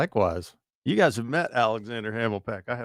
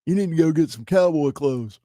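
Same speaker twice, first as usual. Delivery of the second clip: higher, louder boss impression; imitating the boss; 7 semitones higher, 5 dB louder than usual. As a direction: voice the program director. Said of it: "that's our program director".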